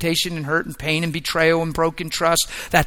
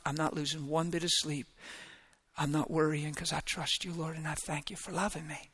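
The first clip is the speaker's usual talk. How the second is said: much softer, calm prayer to God; quiet trusting prayer; 6 dB quieter than usual. Retold or imitated. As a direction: murmur a peaceful private prayer. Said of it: "realizing it and going".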